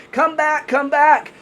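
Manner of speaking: high-pitched, urgent mock yell; retold yelling. Woman to group heading down the mountain, imitated yelling. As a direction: call them back high and urgently, without full volume. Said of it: "they hear Yumila yelling".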